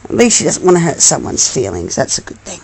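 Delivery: usual speaking voice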